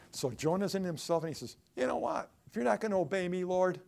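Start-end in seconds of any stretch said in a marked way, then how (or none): 1.7-3.8 loud, high petulant protest; pouting. Acted. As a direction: sulk at God in a raised voice. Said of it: "What a pouting servant of the Lord".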